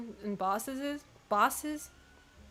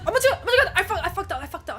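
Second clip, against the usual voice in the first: panicked shout; 6 semitones higher, 6 dB louder, faster than usual. Real, not acted. Presses wrong button mid-fight.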